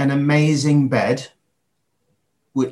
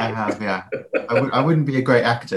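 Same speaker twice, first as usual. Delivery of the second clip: laughing; 2 semitones lower, faster than usual. Real, not acted. Laughing at his own failed accent.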